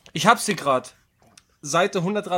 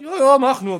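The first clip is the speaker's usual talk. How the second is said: mock ice-cold; 7 semitones higher, 7 dB louder than usual. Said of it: "C achtzehn wirklich so eiskalt".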